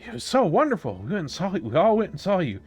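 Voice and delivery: high, eager, fan-like chatter; starstruck little villager. Acted. Burnbomon asks the heroes about their visit, high and eager. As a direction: gush like a shy fan meeting his idols. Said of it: "Burnbomon is like".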